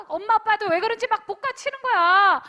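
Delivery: usual speaking voice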